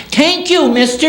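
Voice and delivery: put-on high child's voice, dropping at the end; grateful little boy. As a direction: thank a grown-up like a small kid. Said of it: "he gave it to me and I says".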